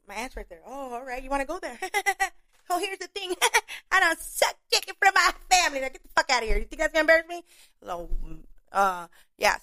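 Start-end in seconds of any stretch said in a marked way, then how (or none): 0.6-7.4 higher, laughing clap-back at the heckler; sassy comeback. Retold or imitated. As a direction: fire back at him with a laugh. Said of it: "retorting to comic".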